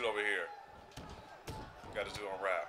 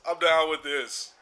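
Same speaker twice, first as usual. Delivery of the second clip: ordinary talk, nothing marked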